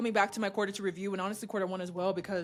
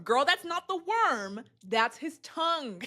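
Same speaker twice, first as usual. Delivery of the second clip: drawn-out, higher-pitched teasing warning; mock warning; 6 semitones higher, slower than usual. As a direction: stretch the warning out slowly for comic effect. Mock warning between the sisters.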